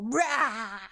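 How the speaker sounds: loud wordless "rah"; mock berserk roar. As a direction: let out a comic ape-like roar. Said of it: "full ape shit and just".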